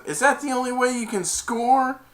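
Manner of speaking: high, loud jeer; mock taunt. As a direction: needle a rival across the field. Retold taunt from player.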